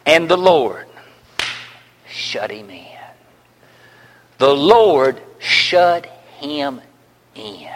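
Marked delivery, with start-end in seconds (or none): 2.1-2.9 sudden whisper, very soft; hushed whisper. Real, not acted. 4.4-7.7 slow and low, drawn out; slow, low emphasis. Real, not acted.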